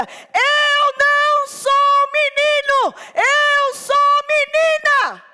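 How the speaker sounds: very high, loud, drawn-out shout. Retold or imitated. Boy shouting to his mother, very high, slow and loud. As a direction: bellow each word slowly like a defiant child. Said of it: "Mais forte ainda".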